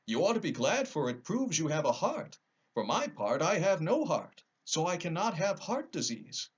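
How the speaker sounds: a kind metal man suggesting; lightly voiced tin woodman.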